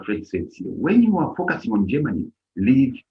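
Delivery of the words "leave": ordinary delivery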